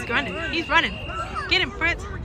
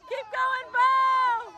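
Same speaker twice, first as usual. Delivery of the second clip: normal talking voice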